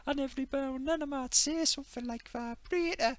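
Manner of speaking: high mock Geordie chuckle and cheeky line; Sarah Millican impression. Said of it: "Oh, Sarah Millican".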